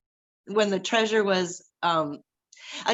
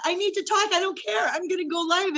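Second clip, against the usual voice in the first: urgent inner voice; 8 semitones higher, faster than usual. Retold or imitated. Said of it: "I just thought".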